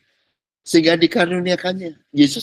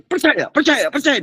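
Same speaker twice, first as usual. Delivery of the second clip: mock pushy preacher; 6 semitones higher, 4 dB louder than usual. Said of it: "Teriak-teriak, jingkrak-jingkrak".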